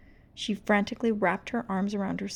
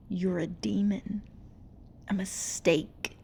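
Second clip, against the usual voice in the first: sneer; 3 dB quieter than usual. Acted. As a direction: taunt her in a cold hiss. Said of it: "they sneered".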